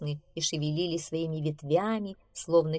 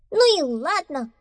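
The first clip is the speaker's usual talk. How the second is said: high, loud huffy squeak; shouting little mouse; 10 semitones higher, 6 dB louder than usual. Acted. Mouse left behind by friends, shouting. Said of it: "крикнул мышонок".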